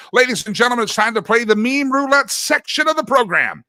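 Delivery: high, loud segment introduction; show announcer. Acted. Host launching the meme roulette section, hyped announcer voice.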